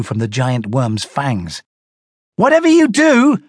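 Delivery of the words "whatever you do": shouted. A narrator's reading. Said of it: "Donnie shouted at me".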